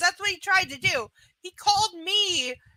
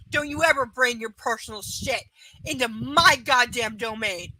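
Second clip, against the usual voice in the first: ordinary talk, nothing marked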